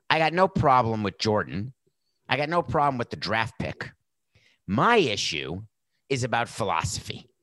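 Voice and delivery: normal talking voice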